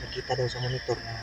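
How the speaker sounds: hushed whisper, staying hidden; whispering while hiding. Real, not acted. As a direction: keep it down, they're close. Hiding near hunters, very soft.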